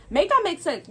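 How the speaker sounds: loud, high exasperated demand; exasperated outburst. Real, not acted. Ranting to viewers about the garbage bag, exasperated. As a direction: throw it at them in disbelief.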